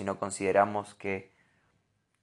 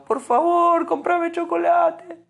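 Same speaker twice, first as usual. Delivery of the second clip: put-on much higher, slow, whimpering child plea; mock crying child; 6 dB louder, slower than usual. Son begging his father for chocolate, mock tearful whine. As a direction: beg like a child near tears. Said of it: "o me lo dice llorando".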